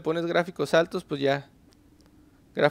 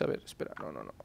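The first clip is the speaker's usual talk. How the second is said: whispered, much softer, mumbled to himself; under his breath; 15 dB quieter, faster than usual. Real, not acted. Player fixing the settings mutters to himself, whispered. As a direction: drop to a private whisper.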